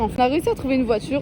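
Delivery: phrase bâclée, très rapide; expédie la phrase à toute vitesse; débit précipité. Real, not acted. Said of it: "je bâcle". (parle vite en bâclant)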